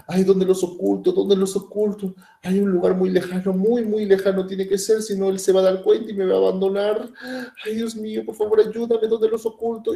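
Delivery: voz mucho más aguda y suplicante; hembra desesperada. Retold or imitated. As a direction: implora angustiada por esconder sus huevos. La hembra busca escondite desesperada. (la hembra teme el abandono, voz aguda y desesperada)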